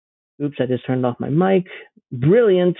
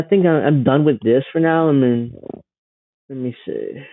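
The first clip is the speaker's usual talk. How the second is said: ordinary talk, nothing marked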